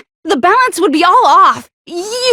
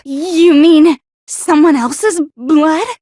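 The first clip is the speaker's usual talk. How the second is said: ordinary talk, nothing marked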